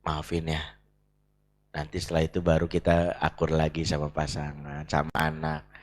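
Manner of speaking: husband gently making peace with wife; calm apology. Retold or imitated. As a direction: say sorry quietly after calming down. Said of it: "Suami akhirnya setelah tenang bilang".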